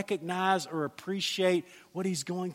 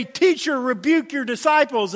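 loud, higher indignant demand; religious leaders' demand; 6 semitones higher, 6 dB louder than usual. Retold or imitated. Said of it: "They say".

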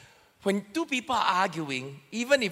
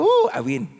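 high, louder mock triumph; hammed-up victory whoop into a laugh; gleeful inner gloating; 7 semitones higher, 6 dB louder than usual. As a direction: crow playfully over a secret win. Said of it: "in my heart".

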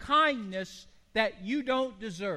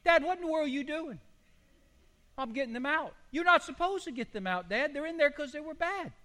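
higher, faster mock-scolding voice; scolding parent; 7 semitones higher than usual. Parent scolding grandpa for taking the boys out, higher mock scold. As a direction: chide grandpa like an exasperated parent.

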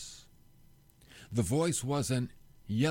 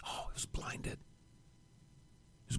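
whispered and much softer, in awe; hushed whisper; 16 dB quieter than usual. Acted. Dramatic retelling of the blinding.

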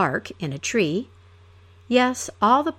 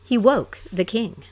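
ordinary delivery, nothing marked

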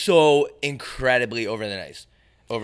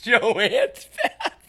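high, slow, laughing through it; cracking up mid-word; 12 semitones higher, much slower than usual. Real, not acted.

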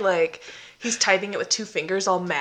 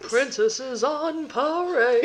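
grand announcer; 5 semitones higher, much slower than usual. Pretend. Co-host riffs on the story.

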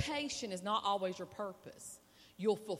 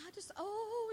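put-on moved old lady; 14 semitones higher, 3 dB quieter than usual.

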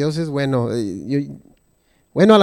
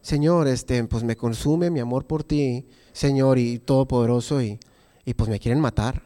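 calm and flat; 7 semitones lower, 5 dB quieter than usual. Retold or imitated. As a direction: recite it coolly, like a form. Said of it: "no creo que Elías estaba".

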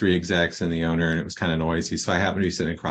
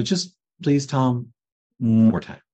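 higher-pitched, drawn-out retold plea; mock pleading; 5 semitones higher, 3 dB louder, slower than usual. Asking Tom to send him out one more time, mock pleading. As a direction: imitate coaxing someone into one last try.

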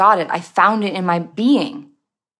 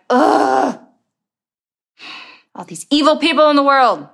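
higher, louder disgusted groan; mock disgust; 6 semitones higher, 3 dB louder than usual. Judgmental person condemning evil people, in a mock disgusted voice. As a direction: sneer at the world's evil people.

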